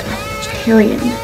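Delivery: breathy whispered mock customer. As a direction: whisper it snootily like a prissy customer. Mocking a customer's whiny request.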